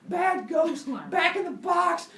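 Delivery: high, loud scolding shout. Acted. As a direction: yell at the ghosts like bad pets. Pandora swatting ghosts with broom.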